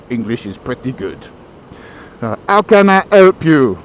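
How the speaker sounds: put-on condescending Frenchman in accented English; caricature French accent. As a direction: put on a haughty French shopkeeper. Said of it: "sorry, French people, for that damning portrayal".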